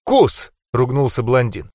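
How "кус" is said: ordinary delivery, nothing marked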